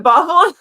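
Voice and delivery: "Babel" loud, as BAH-bel; BAH-bel, questioning. Shown as a demonstration. Reader questions a title's pronunciation, "Babel" as a loud BAH-bel. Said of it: "I think of it like the Tower of Babel".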